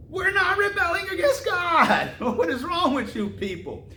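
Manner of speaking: high, mock-offended protest with a laugh; mock indignant protest. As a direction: play the wrongly accused, exasperated. Mocking the accused tribes' protest.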